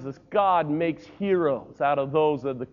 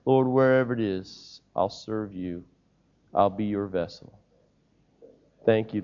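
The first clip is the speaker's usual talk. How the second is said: lower, hushed prayer voice; closing prayer; 5 semitones lower than usual. Acted.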